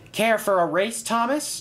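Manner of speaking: high-pitched, playful challenge; lightly amused, pitch lifted right up; chuckling tease. A narrator's reading. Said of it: "chuckled Bertie".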